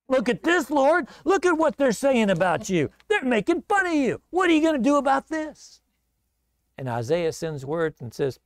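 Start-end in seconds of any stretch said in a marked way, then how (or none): 0.1-5.8 high, upset appeal to the Lord; anguished plea. Acted. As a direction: cry out in indignant prayer. King pleads before God.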